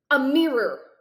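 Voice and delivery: loud, curt angry bark; snapping. Acted. Boy snaps at the doctor for a mirror, loud and angry. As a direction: snap it at them, fed up. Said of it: "I snap".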